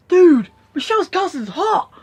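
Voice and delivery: high, loud, drawn-out dude-speak; mock excited coworker. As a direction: imitate a guy gushing to his mate, slow and loud. Acting out coworker's excited remark.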